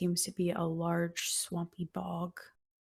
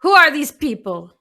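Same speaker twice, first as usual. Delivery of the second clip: put-on booming old witch; 7 semitones higher, 13 dB louder than usual. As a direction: boom it out as the giant crone. GM voices Baba Yaga.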